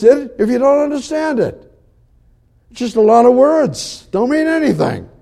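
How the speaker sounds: high, driving sermon voice climbing with fervor; rousing pulpit preaching pitched high; fervent preaching. Acted. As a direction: preach it out to the congregation, lifted high.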